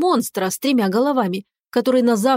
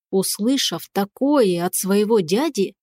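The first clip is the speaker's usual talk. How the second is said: usual speaking voice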